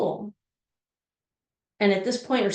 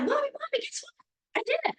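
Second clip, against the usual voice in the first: excited little daughter; 5 dB quieter than usual. Retold or imitated.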